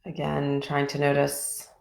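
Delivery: calm, even voice with long pauses between phrases; guided meditation. Real, not acted. Teacher leading a group body scan from toes upward, calm guided meditation.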